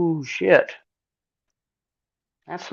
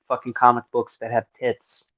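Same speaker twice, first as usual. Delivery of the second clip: usual speaking voice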